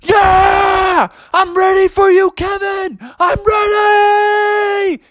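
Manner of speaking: very high drawn-out holler; hammed-up victorious frog scream; triumphant scream. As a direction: scream it into the sky. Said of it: "Froggy started screaming out".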